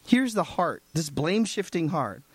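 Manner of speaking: usual speaking voice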